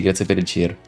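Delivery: ordinary talk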